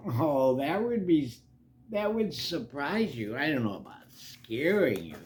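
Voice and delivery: ordinary delivery, nothing marked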